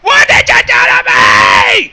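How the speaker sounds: mock outraged cry at a friend; betrayed shout. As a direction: yell it as if wronged.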